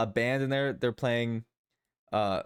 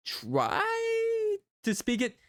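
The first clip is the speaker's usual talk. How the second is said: playful stretched voice; much slower than usual. Pretend. Joking about speaking other languages.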